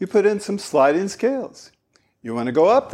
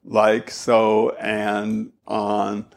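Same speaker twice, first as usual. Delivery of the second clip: drawled filler words; 7 semitones lower, much slower than usual. Shown as a demonstration. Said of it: "fillers like um, like, so".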